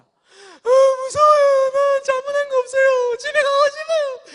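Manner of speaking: put-on high, loud, slow sobbing plea of a terrified child; crying child pleading. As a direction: beg through tears like a frightened kid. Terrified child begging at coffin.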